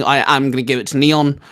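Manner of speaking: ordinary delivery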